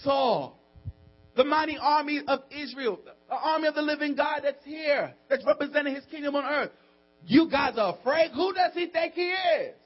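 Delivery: high, impassioned preaching voice; blown-away David. Acted. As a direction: challenge the army in disbelief. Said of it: "David was blown".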